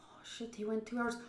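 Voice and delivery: real hushed dismay; whispered oh shit. Real, not acted. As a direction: murmur it under your breath. Whispered shock.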